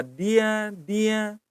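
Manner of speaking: dia with voiced d, held high; pronounced with D. Shown as a demonstration. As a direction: say Dia twice with a clear d. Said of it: "Kalau D itu bacaannya apa".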